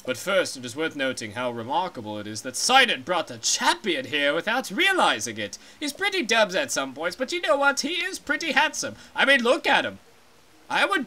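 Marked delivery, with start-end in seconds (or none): 0.0-5.6 lower, grave royal voice; a deep and solemn giant fish king; stately Zora king. Acted.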